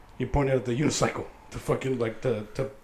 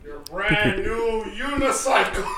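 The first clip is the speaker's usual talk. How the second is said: laughing; 9 semitones higher, 5 dB louder, much slower than usual. Real, not acted. Unveiling the gift.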